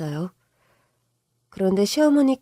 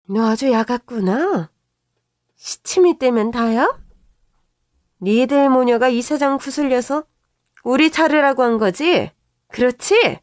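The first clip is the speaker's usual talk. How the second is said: loud, high, accusing shouts; angry accusation; 3 semitones higher, 4 dB louder than usual. Retold or imitated. Mother-in-law accusing daughter-in-law of getting her fired, angry shouting. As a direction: pin the blame on her, loudly.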